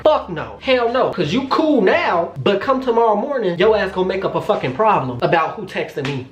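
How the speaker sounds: high-pitched, indignant inner rant; emphatic refusal. Retold or imitated. Woman silently refusing to let boyfriend extend his flight, indignant. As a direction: stretch the words in scornful refusal. In my head, I'm like.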